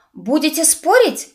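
high, insistent question; cheeky challenge. A narrator's reading. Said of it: "топнула ножкой нахалка". (капризно, с вызовом)

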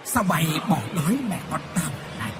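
leise, jammernde Geisterstimme; wailing ghost. A narrator's reading. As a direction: jammere leise wie ein Gespenst. Ghost Hoa wails hungrily.

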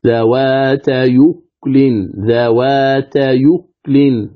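ذوات يكل very slowly, joined as "dhawātayukulin" with no hamza; hamza transfer reading. Shown as a demonstration. Teacher showing how it reads after transfer, ذوات يكل slow and joined. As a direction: draw out ذوات يكل, gliding "yu" straight into "kulin". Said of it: "هكذا تقرأ قراءة صحيحة".

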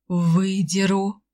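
slow, lightly voiced stern grandfather; a hint of a grim old man's threat; gloomy old grandpa. A narrator's reading. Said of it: "мрачно пообещал дедушка".

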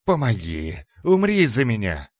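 громкая отчаянная мольба демона; кричи, хрипя, зови на помощь; хриплый крик. A narrator's reading. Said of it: "хрипя, закричал Раздор". (хриплый вопль о помощи)

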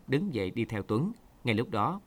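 plain reading voice

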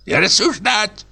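put-on high, thin old man's command; hoarse old man's voice. A narrator's reading. Old director silences narrator, hoarse and high. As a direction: rasp the order like an old boss. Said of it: "крикнул старческий голосок".

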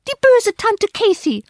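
put-on very high little-child wail; high, loud tearful accusation; crying child's yell. A narrator's reading. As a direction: wail it like an upset small child. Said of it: "begann beim Anblick meiner Tränen gleichfalls zu weinen und schrie".

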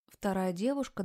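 ordinary delivery, nothing marked

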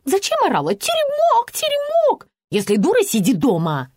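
high mimicking jeer, then scolding; mock the shout in a squeal, then snap; irate, high-pitched taunt; angry mocking. A narrator's reading. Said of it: "разозлилась его жена".

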